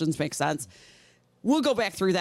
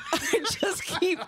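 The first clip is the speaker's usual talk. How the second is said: laughing; 11 semitones higher than usual. Real, not acted. Laughing at own numb mouth.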